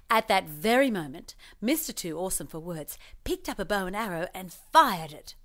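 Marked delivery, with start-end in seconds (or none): none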